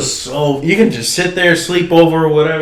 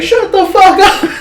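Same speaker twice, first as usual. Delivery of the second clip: mock yell; 3 dB louder than usual. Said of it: "Everyone's like".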